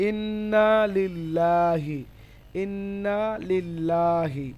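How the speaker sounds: ইন্না লিল্লাহি very slow, long "naa" and light "laa"; thin Lam pronunciation. Shown as a demonstration. Teacher gives a thin example, ইন্না লিল্লাহি slow with light Lam. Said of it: "পাতলা বা বারিক হয়".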